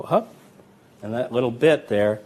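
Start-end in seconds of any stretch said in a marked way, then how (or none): 0.0-0.3 sharp hup. Shown as a demonstration. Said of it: "I go".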